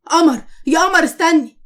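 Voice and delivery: loud call after someone leaving. Acted. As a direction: call her back before she's gone. Ahmed calls after leaving Qamar.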